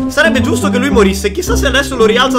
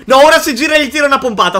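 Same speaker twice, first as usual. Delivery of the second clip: excited; 5 semitones higher, 4 dB louder, much faster than usual. Real, not acted. Excited prediction.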